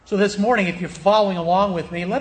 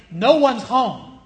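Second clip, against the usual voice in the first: higher, slowly punched "No one's home"; emphatic declaration; 4 semitones higher, 4 dB louder, slower than usual. Retold or imitated. Preacher voicing Paul's point to the congregation, emphatic.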